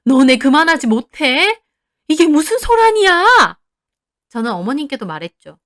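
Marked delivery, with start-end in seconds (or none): 0.0-3.6 mother-in-law yelling at quarrelling family; loud scolding, climbing much higher; angry shout. A narrator's reading. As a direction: holler to break up a fight. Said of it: "어머님도 소리 지르더군요".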